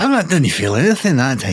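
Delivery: put-on Scottish accent; Scottish Italian accent. Co-host reports an unfelt quake, in a comic Scottish accent. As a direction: play an unimpressed Scot. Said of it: "Scottish Italian".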